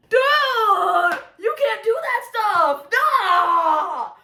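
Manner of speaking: high, loud squealing shout; freaking out. Real, not acted. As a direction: scream with giddy delight. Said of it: "freaking out".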